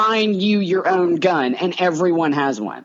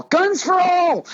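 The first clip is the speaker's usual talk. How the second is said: mock rallying cry; 10 semitones higher, slower than usual.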